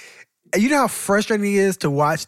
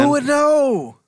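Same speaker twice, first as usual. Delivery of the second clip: high mocking question; 10 semitones higher, 3 dB louder, much slower than usual.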